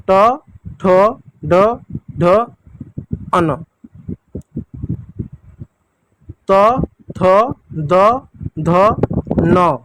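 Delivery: each letter spaced and clear; teacher leading the alphabet chant; letter drill. Shown as a demonstration. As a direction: recite for children to repeat. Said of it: "एक बार मेरे साथ पढ़िएगा".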